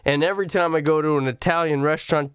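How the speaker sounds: usual speaking voice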